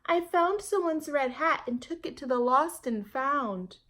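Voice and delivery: put-on a small girl explaining herself; little girl voice. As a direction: play young Jamaica answering her mother. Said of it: "Jamaica said".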